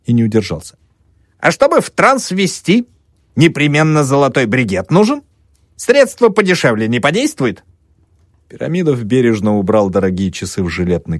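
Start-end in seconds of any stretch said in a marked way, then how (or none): 1.4-7.6 mocking question. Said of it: "весело хмыкнул".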